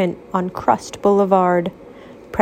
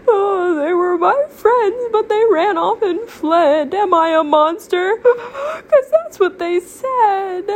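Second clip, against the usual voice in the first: weeping; 11 semitones higher than usual. Acted. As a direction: cry it out, heartbroken. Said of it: "sat weeping alone".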